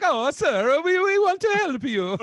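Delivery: mock sugary teller. Teller to a customer, hammed-up sugary voice. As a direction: gush over the customer, overdone. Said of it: "She's like".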